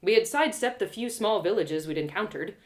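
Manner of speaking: normal talking voice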